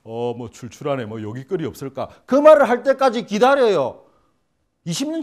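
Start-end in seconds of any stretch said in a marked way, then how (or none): 0.0-2.1 overly polished phrasing. Retold or imitated. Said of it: "꼭 앉아갖고".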